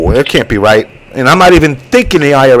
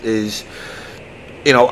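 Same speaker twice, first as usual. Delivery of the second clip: ordinary talk, nothing marked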